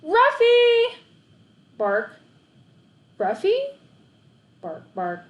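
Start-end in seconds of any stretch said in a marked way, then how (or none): 0.0-1.0 very high, loud shout; boy yelling for his lost dog; yelled as loud as possible. A narrator's reading. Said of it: "yelled as loud as I could". 3.2-3.8 high rising questioning call; boy hearing a bark and calling back; hopeful call. A narrator's reading.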